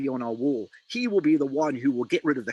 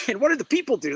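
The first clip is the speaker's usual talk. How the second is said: laughing question; 9 semitones higher, 5 dB louder, much faster than usual. Real, not acted. Laughs while asking.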